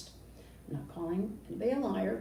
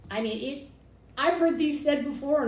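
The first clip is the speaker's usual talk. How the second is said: usual speaking voice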